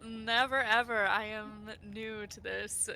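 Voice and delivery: usual speaking voice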